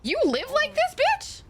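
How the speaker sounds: incredulous high-pitched jab; disgusted squeal. Real, not acted. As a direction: shriek in disgust.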